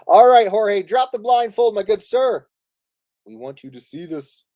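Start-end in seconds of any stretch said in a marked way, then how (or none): 0.1-2.5 bright, loud and jokey, calling across the table; playful loud call. Real, not acted. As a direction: announce it like a grand reveal. Calling player to look up.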